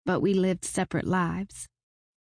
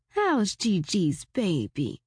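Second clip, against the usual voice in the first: cooing; slower than usual. A narrator's reading. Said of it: "I cooed".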